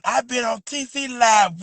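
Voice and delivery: ordinary delivery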